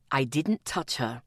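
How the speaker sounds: low, calm male voice; Atticus's low voice. Acted. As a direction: deny it in a deep voice. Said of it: "he replied".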